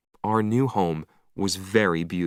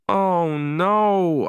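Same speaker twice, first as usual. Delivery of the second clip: dismay; 9 semitones higher, 4 dB louder than usual. Acted. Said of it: "I thought".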